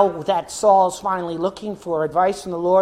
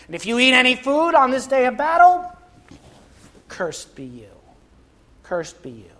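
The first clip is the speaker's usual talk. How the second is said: Saul's curse; 6 semitones higher than usual. Acted. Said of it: "Saul was saying".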